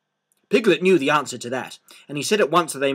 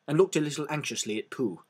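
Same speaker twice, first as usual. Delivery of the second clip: ordinary delivery, nothing marked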